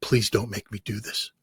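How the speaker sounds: soft, pleading mock whisper; the translator pleads with him at the microphone, in a mock whisper. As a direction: beg him quietly, half-voiced. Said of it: "She's just whispering to me".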